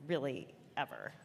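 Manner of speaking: normal talking voice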